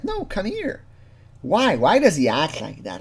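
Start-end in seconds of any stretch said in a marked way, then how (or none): 0.0-0.8 high, soft mock voice; mock James. James telling the decoy to come, high soft mock voice. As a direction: imitate him in a small high-pitched voice. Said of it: "And James, like".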